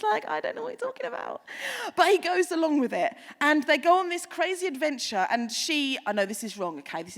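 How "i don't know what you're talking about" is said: high, soft, rushed protest; put-on squeaky bewilderment, quick and small; baffled assistant impression. Assistant denying his boss's claim, high quick baffled voice.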